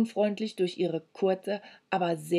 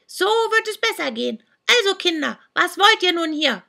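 put-on much higher, louder witch voice of an old woman; shrieking old hag; 11 semitones higher, 6 dB louder than usual. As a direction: screech at the kids like a deaf old crone. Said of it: "schrie sie". (kreischende alte Hexe)

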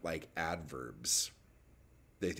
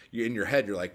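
ordinary delivery

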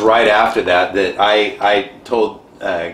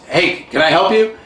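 light imitation of a gruff priest calling out, louder; a hint of a stern old priest hailing a stranger; storyteller lightly voicing the priest who walks his dog; 9 semitones higher, 5 dB louder than usual. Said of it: "he's kind of a gruff old priest".

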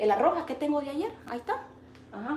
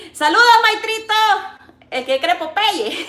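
mock kid's greeting; 9 semitones higher, 11 dB louder than usual. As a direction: call it out like a cheeky kid. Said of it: "me dice un bicho". (burla de cipote gritando)

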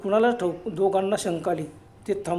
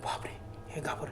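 much softer, frightened whisper; terrified whisper; 5 semitones lower, 13 dB quieter than usual. A narrator's reading. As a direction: gasp it out in terror. Terrified at the stranger's noise.

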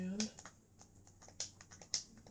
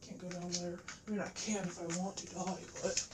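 normal talking voice

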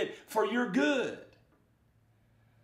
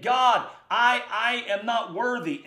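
higher, louder confession of unworthiness; acted pleading; 5 semitones higher, 5 dB louder than usual. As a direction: plead to God as a humbled prophet. Acting Isaiah pleading unworthiness.

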